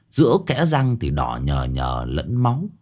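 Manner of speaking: plain reading voice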